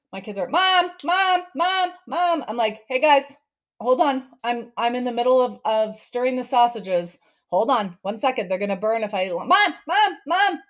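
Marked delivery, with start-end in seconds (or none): 0.5-2.4 put-on high, loud whiny child repeating Mom; nagging kids. 2.9-9.3 put-on raised, louder voice holding kids off; busy mom. 9.3-10.6 put-on high, loud whiny child repeating Mom; nagging kids.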